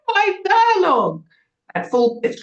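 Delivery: high, drawn-out mock shriek; piercing, loud singsong yell. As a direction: shriek it at full pitch, stretching it out.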